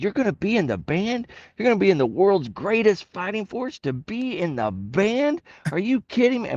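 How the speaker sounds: a hint of a drill instructor tearing into a recruit. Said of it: "he's doing the drill instructor thing".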